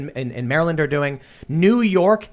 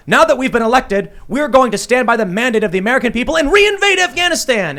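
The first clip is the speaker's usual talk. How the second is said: higher, louder pompous politician speech; lampooning a newly elected hawk; mock neocon; 8 semitones higher, 6 dB louder than usual. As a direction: ham up a grandstanding victory speech. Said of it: "they go".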